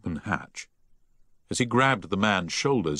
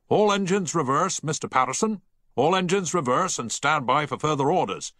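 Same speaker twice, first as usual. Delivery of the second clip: captain bellowing, higher and raised; bellowed order; 8 semitones higher than usual. A narrator's reading. Captain orders the engine room to reverse, bellowing. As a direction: bellow it down the voice pipe. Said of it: "bellowed down the voice pipe".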